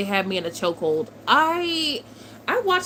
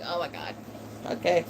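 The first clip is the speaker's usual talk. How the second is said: ordinary delivery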